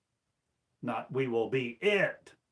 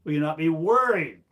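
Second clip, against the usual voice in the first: rising exhortation; 5 semitones higher, 10 dB louder than usual. Real, not acted. Sudden rhetorical outburst.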